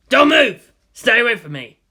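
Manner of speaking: loud, high cry to stay back; terrified order; panicky yell. A narrator's reading. Robber panics at countdown.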